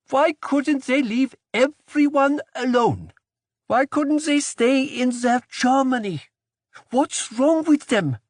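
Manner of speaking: high, pinched angry outburst. Acted. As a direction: spit out her frustration at the Germans. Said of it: "Her voice was angry now".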